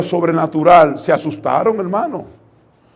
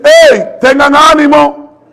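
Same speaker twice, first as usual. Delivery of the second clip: higher, louder, slow shout of encouragement; called out loudly; 7 semitones higher, 6 dB louder, slower than usual. Acted. Jesus calling to disciples on the stormy sea, loud and slow. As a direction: shout it across the waves. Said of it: "Jesús le dice".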